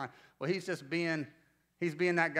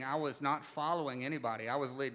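usual speaking voice

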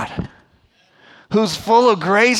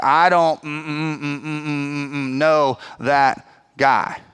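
censored cursing; 4 semitones lower, slower than usual. Retold or imitated. Peter denying Jesus, curses hummed out. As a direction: mumble mm in place of swearing, slowing at the end. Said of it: "he cursed up and down".

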